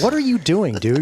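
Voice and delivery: confronting the bully friend; mock outrage. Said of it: "I'm like".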